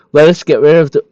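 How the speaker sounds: words stretched by a real yawn. Real, not acted. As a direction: read it mid-yawn. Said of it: "why do I keep yawning".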